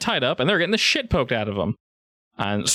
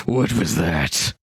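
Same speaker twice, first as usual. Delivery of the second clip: lower, slow ogre voice; stunned ogre; 5 semitones lower, much slower than usual. Acted. Ogre chief asks Sarabi about the ice, low and slow.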